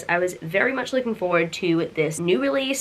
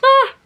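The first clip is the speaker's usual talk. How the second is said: a sudden high, loud squeal of delight; 7 dB louder than usual. Real, not acted. Unboxing a book, squealing at the cover patterns. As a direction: squeal as the patterns grab you.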